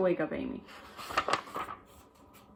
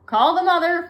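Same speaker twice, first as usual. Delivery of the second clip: urgent call; 10 semitones higher, 7 dB louder than usual. Acted. Teacher urgent.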